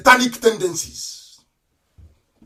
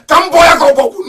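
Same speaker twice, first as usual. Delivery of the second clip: high, loud cry; a sudden shouted outburst; angry shout; 8 semitones higher, 10 dB louder than usual. Real, not acted. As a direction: fling it out in fury.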